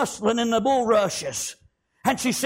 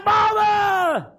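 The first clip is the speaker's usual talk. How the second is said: high, urgent acted shout; child's loud call, acted by a preacher; 10 semitones higher, 4 dB louder than usual. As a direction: burst in hollering for mother. Daughter bursts in calling mother.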